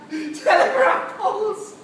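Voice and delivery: high, loud, slow through laughter; hysterical, scornful echo; incredulous mockery. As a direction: laugh out the words in disbelief. Mocking the cause of war.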